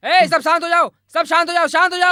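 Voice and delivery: urgent high call over a commotion. Acted. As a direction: shout the crowd down, insistently. Calling a crowd to order.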